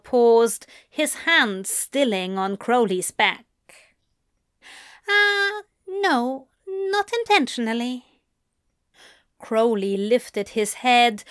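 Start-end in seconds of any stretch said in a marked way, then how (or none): 5.1-8.0 embarrassed Aziraphale. A narrator's reading.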